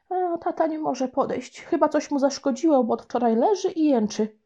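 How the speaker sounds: high, put-on worried little boy; boy fibbing. Boy tells grandma on the phone that mum is ill, fibbing in a high worried voice. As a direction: sell the lie with a sad tone.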